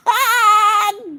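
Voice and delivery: high peal of laughter; delighted laugh. Real, not acted. Gifts from Alex keep coming, delighted laugh. Gift count keeps climbing.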